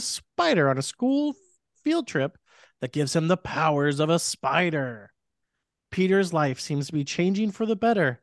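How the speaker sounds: dramatic trailer narrator voice; movie trailer announcer. Acted. Reads plot as announcer.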